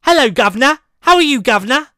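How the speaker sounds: exaggerated high, loud Cockney-posh British voice; over-the-top British gent accent; mock posh British. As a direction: ham up a stage Brit greeting. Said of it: "sound like as if he goes".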